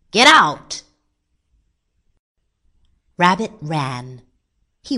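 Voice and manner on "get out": loud, higher angry shout. A narrator's reading. Man chasing rabbit from the garden, shouting. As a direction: yell it to chase someone off.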